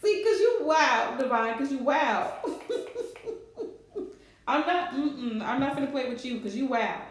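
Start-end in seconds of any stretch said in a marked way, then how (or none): 2.4-4.1 high breathy laugh. Real, not acted. Laughing at friend's comment.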